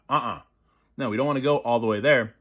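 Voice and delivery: normal talking voice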